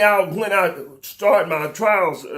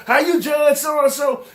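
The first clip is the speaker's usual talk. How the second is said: mock flattery; 6 semitones higher, slower than usual. Mocking people flattering officials.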